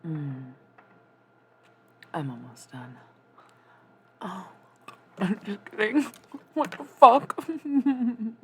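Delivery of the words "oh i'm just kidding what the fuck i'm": loud surprised laughing outburst. Real, not acted. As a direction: laugh it off loudly. Startled, then laughing loudly.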